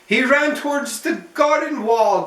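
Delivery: ordinary delivery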